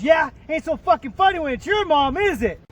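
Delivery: loud, high taunting comeback; smug retort. Retold or imitated. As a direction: throw it back at him with a sneer. Son's cheeky comeback to dad.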